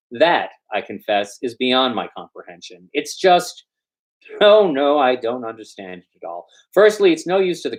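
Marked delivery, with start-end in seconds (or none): none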